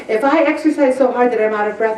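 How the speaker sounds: ordinary talk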